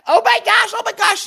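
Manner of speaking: high, loud squealed mock horror; mock shock. Mocking an online opponent over internet stuff, high mock-shocked squeal.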